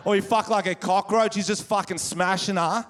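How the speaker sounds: ordinary talk, nothing marked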